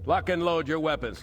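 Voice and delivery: commanding shout to marines; calling out orders. Acted. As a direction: bark it across the room to the squad. Officer orders troops to arm.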